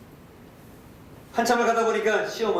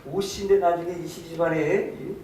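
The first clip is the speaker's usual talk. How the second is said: ordinary delivery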